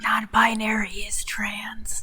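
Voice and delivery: slow whispered aside; whispering. Real, not acted. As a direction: whisper the correction slowly. Quiet correction aside.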